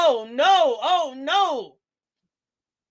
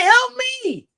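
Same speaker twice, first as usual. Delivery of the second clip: mock plea; 11 semitones higher, 6 dB louder than usual. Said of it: "Did she say".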